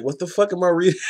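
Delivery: high-pitched baffled outburst; retold disbelief. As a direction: relive the shock of a first read. Retelling own shocked first reading.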